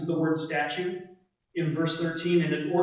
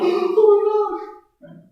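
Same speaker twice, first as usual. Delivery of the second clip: high, loud, drawn-out gasp of awe; hammed-up astonished crowd, voice shooting way up; mock amazement; 9 dB louder, slower than usual. Imitating people amazed by a moving statue, mock astonishment.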